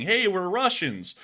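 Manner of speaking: imitated yell. Ukrainians shouting to Russian trenches, mock yell. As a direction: call it out like a soldier faking it. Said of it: "because they were yelling".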